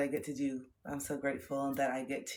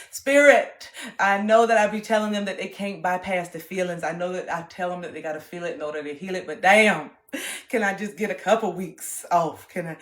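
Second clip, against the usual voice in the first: loud, higher laughing plea; mock desperate plea; 3 semitones higher, 12 dB louder than usual. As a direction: beg Spirit for a break, laughing. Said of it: "I said".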